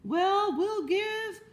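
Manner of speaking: high, ringing public-address call; loudspeaker announcer. Acted. Loudspeaker addressing the fair crowd, grand announcer. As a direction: hail the whole fairground. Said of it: "said the loudspeaker".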